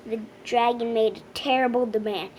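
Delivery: ordinary delivery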